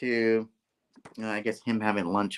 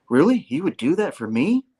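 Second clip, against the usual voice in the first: higher-pitched, lightly acted amazement; mock pleased surprise; 6 semitones higher, 4 dB louder than usual. [name] offered full-time pay by Norman, acted surprise. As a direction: play grateful disbelief, pitch lifting.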